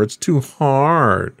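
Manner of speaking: put-on drawn-out, mildly whiny teen complaint. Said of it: "That was my whiny, whiny voice".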